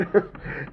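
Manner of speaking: a short airy giggle; breathy laugh. Real, not acted. Amused retelling the scene, airy giggle. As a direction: laugh under your breath.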